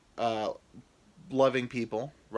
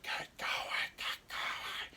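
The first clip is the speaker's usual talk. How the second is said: urgent whisper; whispered urging; 9 dB quieter, much slower than usual. Acted. His penis hurrying him home, whispered. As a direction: shove him along under his breath. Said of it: "my penis is just yelling and cussing".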